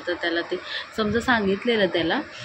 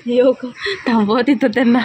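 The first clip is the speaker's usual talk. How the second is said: loud, higher teasing call; playful shouting; 5 semitones higher, 7 dB louder than usual. Real, not acted. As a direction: shout back in mock alarm, laughing.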